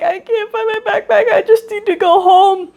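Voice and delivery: put-on high, tearful little-kid voice; imitating a crying six-year-old; crying child. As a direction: plead like a lost kid who wants home. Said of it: "'Cause I'm crying at this point".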